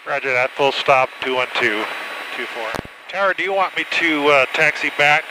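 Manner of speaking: ordinary talk, nothing marked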